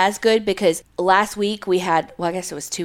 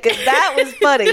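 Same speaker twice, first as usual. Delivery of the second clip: ordinary talk, nothing marked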